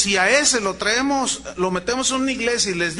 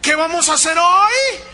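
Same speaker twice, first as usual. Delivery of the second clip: high, loud hype-man call; rallying coach; 8 semitones higher, 6 dB louder than usual. Retold or imitated. Motivator firing up store employees, loud. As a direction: pump up the room like a sales coach.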